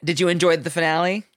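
talking around ice; words round a mouthful of ice. Real, not acted. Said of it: "I have ice in my mouth".

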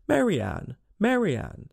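brisk calling, lifted pitch. A narrator's reading. Rabbit outside calling for Mary Ann, calling out.

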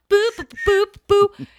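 high loud beeping chime; train chime beeps. Retold or imitated. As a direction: imitate the subway door chime. Imitating train chime sound.

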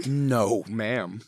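usual speaking voice

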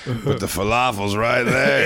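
slow, whiny friend impression; a hint of a disappointed friend; imitating his friend. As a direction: mimic your buddy pointing at the food. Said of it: "You do a better impersonation of me".

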